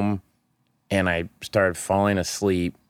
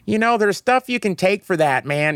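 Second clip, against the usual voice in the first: high, thin-pitched rocker impression, a bit louder; 13 semitones higher, 4 dB louder than usual. Rock star offering backstage advice to a sick performer, high mock voice. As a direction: imitate an old rocker way up in pitch. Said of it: "someone like [name] being like".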